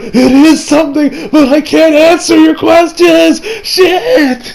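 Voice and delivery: high, drawn-out panicky whine; mock panic. Retelling the boy who can't answer questions, mock panic. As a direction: stretch it out in a high, hammed-up panic. Acting out the cornered boy's panicked reply.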